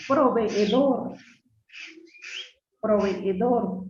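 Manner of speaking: "Proveedor" drawn out slowly, syllable by syllable, double e held; slow syllable demo. Shown as a demonstration. Teacher spells out syllables for the class, slowly. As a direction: stretch "Proveedor" so each syllable is heard. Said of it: "¿Cuántas sílabas tiene?".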